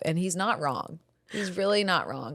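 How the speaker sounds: ordinary talk, nothing marked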